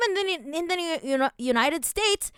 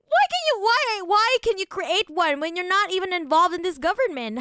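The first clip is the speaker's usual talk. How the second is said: indignant outburst; 6 semitones higher, 4 dB louder than usual. Real, not acted. Indignant questioning.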